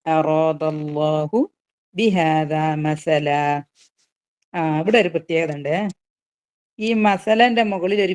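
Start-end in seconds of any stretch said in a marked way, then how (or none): 0.1-3.3 very slow, drawn-out chant; slow Quranic recitation. Shown as a demonstration. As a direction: stretch each word in recitation. Recites verse.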